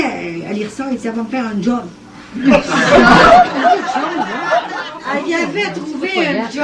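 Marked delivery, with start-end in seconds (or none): 2.1-5.1 high long burst of laughter; real delighted laughter. Real, not acted. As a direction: laugh hard at the ending. Laughing at story end.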